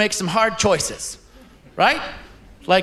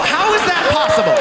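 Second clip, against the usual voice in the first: comic outrage rising into a shriek; mock outrage, high pitched squeal; 13 semitones higher than usual. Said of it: "repeated higher".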